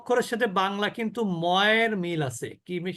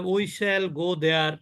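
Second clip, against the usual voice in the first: slowly, each word drawn out; slow English quote; 3 semitones lower, much slower than usual. Shown as a demonstration. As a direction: say it slow and deliberate. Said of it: "তখন সে কি বলে?". (ধীরে ধীরে বলা)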